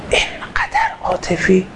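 slow, soft, almost whispered question; hushed wonder. Real, not acted. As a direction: let it out slowly on a hushed breath. Awed question after Imam's tears. (نجوای آهسته و پر از تعجب)

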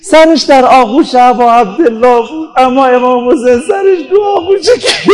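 grief building to a tearful cry; wailing lament. Real, not acted. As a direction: let the last question rise into a wail. Climax of eulogy for Imam Hussein.

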